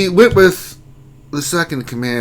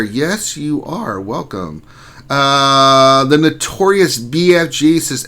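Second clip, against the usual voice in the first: ordinary talk, nothing marked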